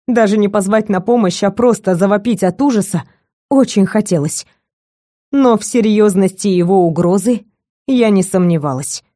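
usual speaking voice

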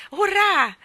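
high, loud hurrah; cheering shout. A narrator's reading. Michael hearing of the next story, cheering loudly. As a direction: let out a big drawn cheer. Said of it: "gridò Michael".

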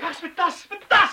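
high, loud desperate shout; panicked shout. Acted. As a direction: yell it at her, frantic. Intruder demanding bag.

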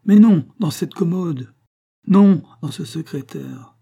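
loud, high, agitated bursts; frantic correction. A narrator's reading. Farmer hunting through the furniture for something lost, frantic. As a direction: hit each "non" hard, urgently.

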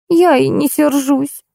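slow, high plaintive moan. A narrator's reading. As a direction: groan it out in a high voice. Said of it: "простонала Ольга". (медленно, жалобным стоном)

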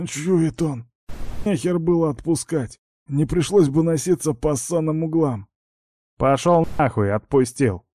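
bickering thugs heard through a door; from another room. Acted.

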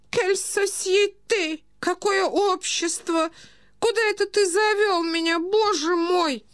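very high, plaintive aristocrat; distressed old prince. A narrator's reading. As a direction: beg to be taken away, helpless and appalled. (старый князь, высокий жалобный голос)

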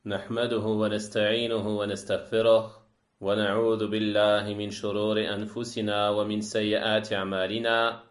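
rapid, level-pitched Arabic recitation; Arabic sermon opening. Acted. Lecturer opening a religious lesson with Arabic praise, formal recitation.

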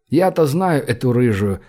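ordinary delivery, nothing marked

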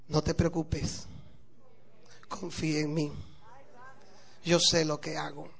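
low, soft, unhurried reassurance; placid calm. Acted. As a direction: serene and sure of himself, softly. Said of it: "muy plácido, así muy tranquilo".

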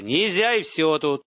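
stubborn irritated no; angry snap. A narrator's reading. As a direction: refuse crossly, ending the argument. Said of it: "Зло огрызнулся молодой".

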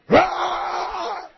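high furious roar; King Kong roar. Retold or imitated. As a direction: roar like an enraged gorilla. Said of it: "me volví King Kong".

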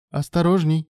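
high-pitched exclamation of alarm; startled cry. A narrator's reading. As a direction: cry out a sudden warning. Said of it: "вскрикнула женщина".

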